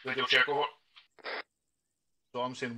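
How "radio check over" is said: loud radio call to squad leader; over walkie talkie. Shown as a demonstration. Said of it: "do something along the lines of this".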